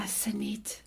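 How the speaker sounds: breathy unvoiced whisper of her own name; whispered self-talk. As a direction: whisper it to yourself, worn out. Whispering to herself on the hike.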